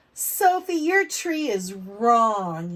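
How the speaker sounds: put-on loud, slow, teasing kid; mocking classmate. Andrew jeering at Sophie's blue tree, loud and slow. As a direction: jeer it at her across the table.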